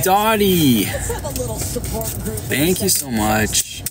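ordinary talk, nothing marked

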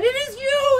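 high and loud; joyful recognition; delighted prince. Acted.

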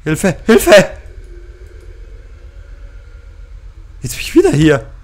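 high, loud frightened yelling; panicked cry for help. Real, not acted. Gamer chased in the game, yelling for help. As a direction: yell for help in real panic.

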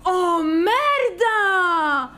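drawn-out cry of amazement; shocked cry. Real, not acted. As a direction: let it out long and stunned. Shocked by the pull.

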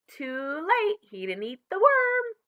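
high, rising triumphant crow; loud, high-pitched acted triumph; gleeful gloating. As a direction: crow over the winning scheme. Schemer gloats over imagined victory.

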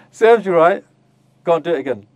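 loud, dismissive telling-off; scornful mother. Retold or imitated. Tough mother scolding her crying child, loud and scornful. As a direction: throw it back at the child with no pity. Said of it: "the third mother, the opposite".

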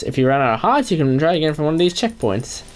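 normal talking voice

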